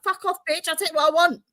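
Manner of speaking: lightly voiced rage at midwife; retold angry retort. Mother in labor telling off the midwife, mock angry. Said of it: "I'm like".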